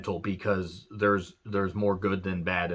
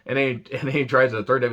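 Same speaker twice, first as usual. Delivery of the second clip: ordinary talk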